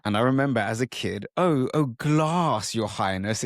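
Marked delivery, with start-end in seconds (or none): 1.4-3.4 high, loud teasing in a mock-posh accent; mock the long ah in "glass". Family teasing a kid's accent, mock-posh. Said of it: "if I asked for a glass of water".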